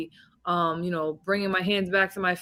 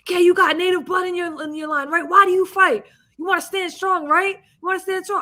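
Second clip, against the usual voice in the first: much higher, louder coach firing her up; hyped-up coach; 11 semitones higher, 6 dB louder than usual. Retold or imitated. A coach hypes his fighter about her native roots, much higher and louder. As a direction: pump her up before the fight. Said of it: "'cause he's like".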